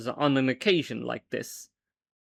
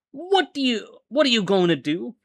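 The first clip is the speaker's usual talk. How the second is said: put-on Southern accent, pitched higher; 7 semitones higher than usual. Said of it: "why am I going southern now?".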